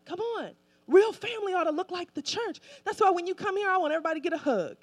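normal talking voice

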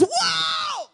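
high, astonished cry of whoa; sudden awed exclamation; awestruck whoa. Acted. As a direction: gasp it out like a man seeing God. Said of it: "and Isaiah says".

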